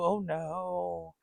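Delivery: usual speaking voice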